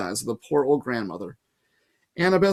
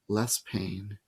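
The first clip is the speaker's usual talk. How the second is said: much softer, lower echo; fading whisper; 6 semitones lower, 9 dB quieter than usual. A narrator's reading. Medusa's lulling echo, fading out. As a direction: let it die away to nothing.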